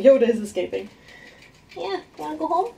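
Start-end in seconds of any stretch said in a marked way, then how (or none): 1.8-2.7 pet talk. Real, not acted. Owner asking her rat if it wants home.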